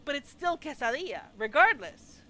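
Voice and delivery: loud insistence. Retold or imitated. Retelling the argument to the co-host, loud laughing insistence. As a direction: hammer home the point, half laughing. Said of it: "I'm like going".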